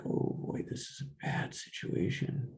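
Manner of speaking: half-whispered inner dread; whispered worry. Retold or imitated. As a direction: hush it like a scared thought. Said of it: "I'm like".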